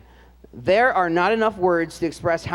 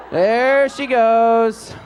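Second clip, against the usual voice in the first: slow, stretched and louder, like a sportscaster; drawn-out excitement; 4 semitones higher, 6 dB louder, much slower than usual. Real, not acted. Band director calling out Katie on screen, stretched and excited. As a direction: savour the moment she runs past. Commentating the clip.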